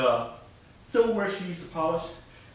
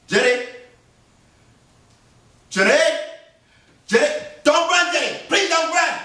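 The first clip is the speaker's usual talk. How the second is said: loud rising calls; a father shouting across the fields; calling after her; 12 semitones higher, 6 dB louder than usual. Acted. Master chases daughter.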